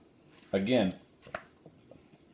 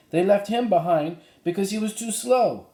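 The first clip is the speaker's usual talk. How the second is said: put-on higher eager girl's voice; voicing Annie; 12 semitones higher, 5 dB louder than usual. Annie tells the cowboy about the foal, higher girl's voice. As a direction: Annie explaining in a bright higher voice.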